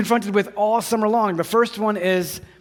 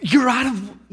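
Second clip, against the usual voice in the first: surprised outburst; 5 semitones higher, slower than usual. Retold or imitated. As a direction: blurt it out in shock. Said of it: "no, shh".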